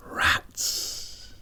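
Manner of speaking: a hushed, voiceless whisper. A narrator's reading. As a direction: breathe it out with dread. Dread-filled single word.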